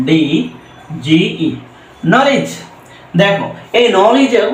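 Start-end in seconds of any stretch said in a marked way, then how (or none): 2.1-2.5 "নলেজ" starting on N, K silent. Shown as a demonstration. Teacher reads out the spelled word for learners, "নলেজ" with silent K. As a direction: say "নলেজ" with no K before the N. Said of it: "এর মতন উচ্চারণ হবে".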